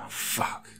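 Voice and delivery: soft, breath-heavy curse; defeated swear under the breath. A narrator's reading. Man realising they're out of luck, swearing. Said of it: "James swore".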